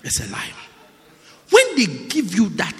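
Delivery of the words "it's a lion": lower, unvoiced whisper of lion; hushed repeat. Real, not acted. Preacher repeats to the congregation, hushed whisper. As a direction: whisper it back for effect. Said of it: "In case you didn't hear me".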